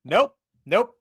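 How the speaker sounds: two high, loud nopes; spooked refusal. Acted. Player's character rejecting the mysterious voice, spooked. Acted refusal after the scare.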